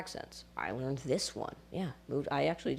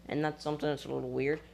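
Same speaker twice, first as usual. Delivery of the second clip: ordinary talk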